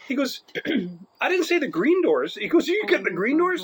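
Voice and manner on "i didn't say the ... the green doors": higher mock angry scolding; angry teacher. Teacher scolding the boy about the green doors, higher mock anger. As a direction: scold like a teacher losing patience.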